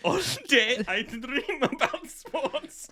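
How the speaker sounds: slow, giggling through every word; can't get it out without giggling. Real, not acted. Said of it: "I couldn't do it without giggling".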